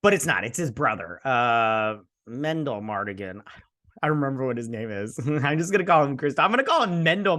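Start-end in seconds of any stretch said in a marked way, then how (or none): none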